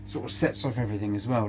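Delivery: usual speaking voice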